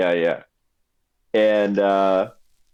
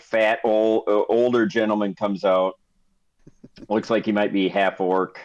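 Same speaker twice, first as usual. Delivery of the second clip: normal talking voice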